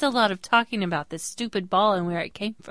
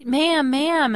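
higher, louder call of ma'am; calling after her; 7 semitones higher, 5 dB louder than usual. Retold or imitated. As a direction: call out to the woman leaving. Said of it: "And she's like".

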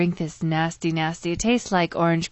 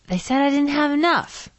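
put-on young guy quote; 7 semitones higher than usual. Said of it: "He goes".

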